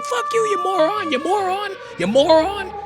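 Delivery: very high-pitched mock rant; imitated screaming. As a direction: hurl insults in a squealing, worked-up voice. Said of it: "he's screaming".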